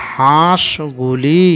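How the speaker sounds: হাঁসগুলি very slow, first a through the nose; nasal vowel. Shown as a demonstration. Teacher reads it for pupils, slow nasal হাঁ in হাঁসগুলি. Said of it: "যেন মনে হয় যে নাক দিয়ে আওয়াজটা বার হচ্ছে".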